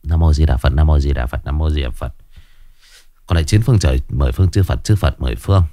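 low, murmured Buddhist chant; murmured prayer. Acted. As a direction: mumble the prayer under your breath. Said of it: "đứng chắp tay rì rầm".

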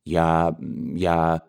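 mother at a loss, hedging, understated; bewildered mother. Acted. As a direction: stammer for time. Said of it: "растерявшаяся генеральша".

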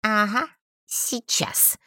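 slow, drawn-out scoff; creaky mocking drawl. A narrator's reading. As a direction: sneer it lazily, dragging the words. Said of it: "хмыкнул голос".

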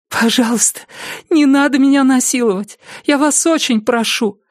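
high-pitched desperate begging; pleading frightened woman. Acted. Captive woman begs attacker to spare her, high pleading.

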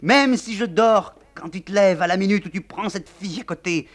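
wife screaming at the general; hysterical screaming. Acted. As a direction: howl it out in fury. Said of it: "La générale hurle".

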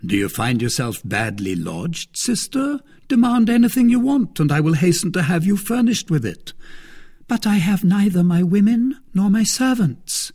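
a smug, teasing brother-in-law; Lord de Winter. Acted.

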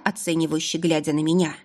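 plain reading voice

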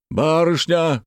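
high startled call; high, urgent exclamation; sudden shout. A narrator's reading. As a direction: cry out on spotting someone. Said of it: "вдруг крикнул староста".

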